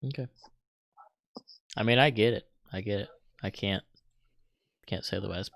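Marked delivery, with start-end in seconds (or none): none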